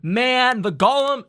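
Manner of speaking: high and loud mock dismay; player quote. Player frets about the golem, mock dismay. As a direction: wail it out as the worried player. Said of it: "was like".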